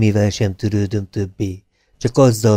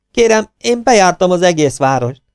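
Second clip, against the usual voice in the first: high, loud defensive plea; defensive protest; 8 semitones higher, 5 dB louder than usual. A narrator's reading. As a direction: insist he searched the whole town. Prop man defending himself.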